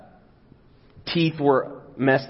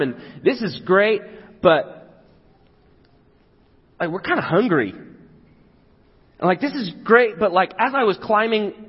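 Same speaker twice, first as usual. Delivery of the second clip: higher, pleading voice of a hungry follower; complaining crowd member; 7 semitones higher than usual. Acted. Hungry follower complains to Jesus, pleading higher voice. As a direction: whine your complaint up to the teacher.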